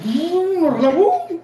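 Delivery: high playful boom sound; mock boom. Streamer mimicking lightning for rival, playful boom. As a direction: shout bum like thunder. Imitating thunder booms.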